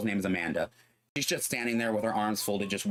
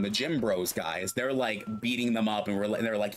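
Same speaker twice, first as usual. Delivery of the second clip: usual speaking voice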